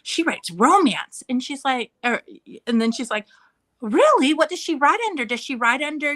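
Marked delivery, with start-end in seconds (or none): 0.0-1.2 slow, louder boastful kid imitation; mock-proud, drawn out and higher. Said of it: "he's like, all proud". 3.8-6.1 high-pitched mock amazement; loud, high opening, eagerly questioning; intrigued teacher. Teacher presses son, acted out.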